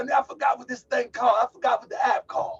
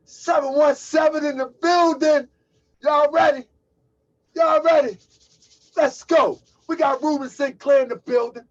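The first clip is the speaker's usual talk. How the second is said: hype call; 7 semitones higher, 9 dB louder than usual. Real, not acted. Hyping up the live audience.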